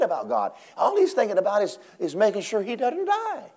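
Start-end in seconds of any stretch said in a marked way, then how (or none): none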